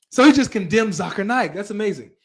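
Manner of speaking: ordinary talk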